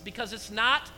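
usual speaking voice

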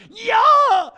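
a huge, high, laughing whoop as the car drops; whooping yell mid-drop; roller coaster scream. Retold or imitated. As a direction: let it rip like you're going over the top.

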